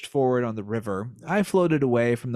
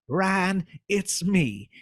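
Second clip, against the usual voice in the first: high, slow Southern twang; sly silky Southern fox; 5 semitones higher, slower than usual. Acted. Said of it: "twang for the voice of the fox".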